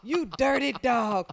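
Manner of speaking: put-on high, slow screaming old lady; screaming old woman. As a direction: scream insults like a frail grandma. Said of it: "she's screaming the whole time".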